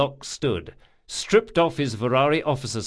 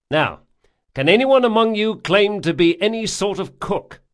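loud, firm captain addressing crew; rally the men from the deck; commanding captain; 6 semitones higher, 5 dB louder than usual. A narrator's reading.

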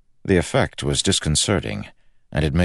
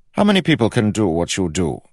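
higher, louder royal voice; king cutting in; 7 semitones higher, 5 dB louder than usual. A narrator's reading. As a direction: cut across him as an imperious king. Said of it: "cut in the king".

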